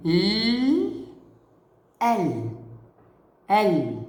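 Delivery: ال said alone, slow and clear, three times; sounding out ال. Shown as a demonstration. Teacher drills ال for pupils, slow and clear. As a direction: repeat ال distinctly for children. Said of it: "يصير لفظها إي".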